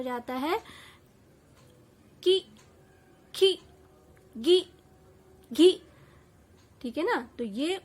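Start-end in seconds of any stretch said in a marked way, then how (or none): 2.2-5.7 short, loud "कि, खी, गी, घी", each vowel cut quick without stretching; झटपट छोटी इ की मात्रा. Shown as a demonstration. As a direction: say "कि, खी, गी, घी" as separate quick syllables with a short i. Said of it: "एकदम जल्दी से". ("कि, खी, गी, घी" एकदम जल्दी से, बिना खींचे, ज़ोर से)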